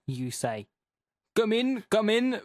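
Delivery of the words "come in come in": higher, badly faked German accent; eager host in a muddled German accent; wandering German accent. Hitler inviting the housemaid in, muddled German accent. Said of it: "what this accent's becoming".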